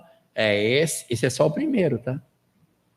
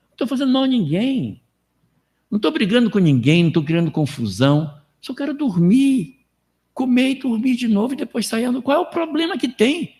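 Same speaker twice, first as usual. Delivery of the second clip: much higher, whiny put-on voice; lazy self-justifier; 10 semitones higher than usual. Mocking a lazy person who thinks sleeping all day hurts no one. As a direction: play the idler who sees no harm, pitched high. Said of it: "Você pensa assim".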